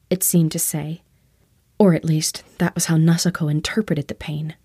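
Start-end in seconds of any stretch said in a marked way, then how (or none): none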